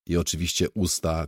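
usual speaking voice

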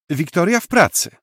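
put-on a small boy stating it like an adult; serious little boy. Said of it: "dodał poważnym tonem". (mały chłopiec, dużo wyżej, z powagą)

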